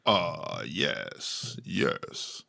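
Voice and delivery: slow, low baritone with long pauses; Riley Martin. Acted. Imitating an alien abductee recalling his experiences, in deep baritone. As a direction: draw it out softly, deep in the chest. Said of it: "he would talk in his very, like, like, baritone voice".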